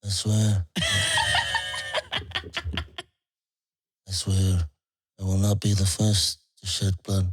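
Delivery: husky mafia don; Marlon Brando impression. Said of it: "Marlon Brando, The Godfather".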